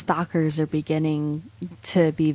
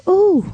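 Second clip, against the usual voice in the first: hammed-up high gasp of delight; mock gasp; 11 semitones higher, 6 dB louder than usual. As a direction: gasp like it's a bargain.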